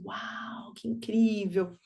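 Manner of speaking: whispery, slow awe; imitated hushed wonder. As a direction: breathe it out softly, amazed. Child gazing in wonder.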